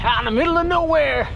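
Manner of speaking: a hint of a drawling Western hero; John Wayne impression. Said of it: "Like John Wayne".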